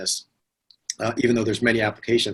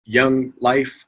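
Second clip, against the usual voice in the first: normal talking voice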